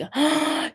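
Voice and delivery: sharp surprised gasp; sudden gasp. Real, not acted. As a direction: gasp as an idea hits. Sudden thought mid-reading.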